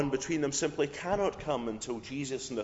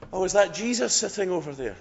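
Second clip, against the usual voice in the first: higher, louder, a hint of Irish; attempted Irish accent; 5 semitones higher, 6 dB louder than usual. Irishman in a restaurant asking about Jesus, slight Irish accent. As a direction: have a brief go at an Irish accent. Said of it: "I won't attempt a, an Irish accent".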